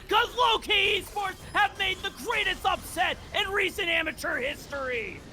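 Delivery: high, shouted championship call; victory call. Real, not acted. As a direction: roar the final moments home. Game-winning call.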